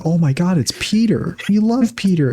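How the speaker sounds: high, excited mock fan gushing; imitated adoring crowd. Deadpools spotting beloved Peter, high mock excitement. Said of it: "All of the Deadpools are like".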